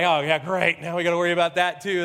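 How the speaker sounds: fast, dry sarcastic aside; sarcastic reply. Retold or imitated. Pastor answering the asbestos warning, quick dry sarcasm. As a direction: toss it off with mock dismay. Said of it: "And I'm going".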